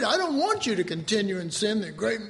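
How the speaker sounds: normal talking voice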